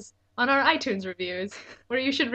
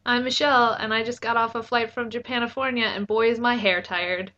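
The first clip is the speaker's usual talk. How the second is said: usual speaking voice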